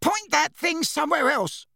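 very high frightened demand; high alarmed protest; panicked. A narrator's reading. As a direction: beg him off in panic, pitch shooting up. Backed against wall by wand.

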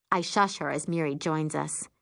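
ordinary delivery

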